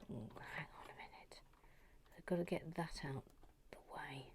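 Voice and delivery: unvoiced concentrating whisper; hushed fast whisper. Real, not acted. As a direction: mutter to herself working.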